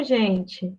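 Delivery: ordinary talk, nothing marked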